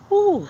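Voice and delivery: high, loud ooh of sore calves; pained ooh. Real, not acted. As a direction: yelp at the ache in the legs. Calves hurting mid-walk.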